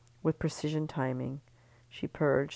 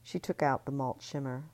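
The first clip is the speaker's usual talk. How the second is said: ordinary delivery, nothing marked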